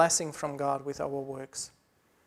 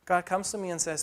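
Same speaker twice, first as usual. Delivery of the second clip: usual speaking voice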